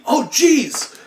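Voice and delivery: high, loud flustered gasp; panicked. Retold or imitated. Cellmate panicking about missing breakfast, high. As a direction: caught out and panicking.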